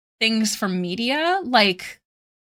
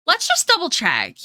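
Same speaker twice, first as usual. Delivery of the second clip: higher mock-officious voice for Twitch; voicing Twitch; 7 semitones higher, 3 dB louder than usual. Streamer mocking Twitch's word filter, mock-officious higher voice. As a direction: play the cautious platform, bit louder. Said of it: "Twitch is like".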